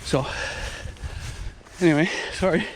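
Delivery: breathless, catching his breath; talking while winded. Real, not acted. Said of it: "I'm a little out of breath".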